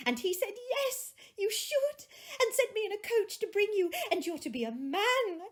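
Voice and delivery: put-on high, bright little girl. A narrator's reading. Little sister telling her brother the news, high child voice. Fan, child, retold speech.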